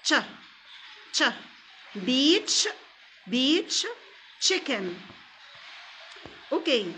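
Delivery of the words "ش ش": "ش" said as the English ch, short and isolated; ch sound demo. Shown as a demonstration. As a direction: say "ش" like the start of "chips". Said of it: "السي اتش نطق ش".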